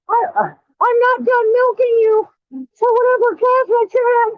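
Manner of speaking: high squeaky put-on voice, hamming it up; mocking falsetto. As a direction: sneer at him in a silly high voice. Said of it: "I'm laughing at you".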